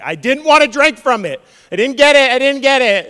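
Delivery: high, loud, whining protest; a loud, high-pitched mock disciple backpedaling; whiny regret. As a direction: whine like you blew it. Disciples voiced regretting their boast.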